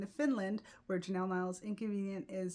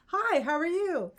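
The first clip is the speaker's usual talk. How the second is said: high, louder over-friendly hello; bubbly greeting; 9 semitones higher, 7 dB louder than usual. Retold or imitated. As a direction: greet everyone brightly like an eager East Coaster. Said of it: "I was just all like".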